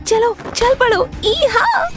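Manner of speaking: excited yeehaw. Acted. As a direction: urge the horse on with a joyful whoop. Girl rides horse at night. (खुशी से ऊँची आवाज़ में घोड़े को हाँकना)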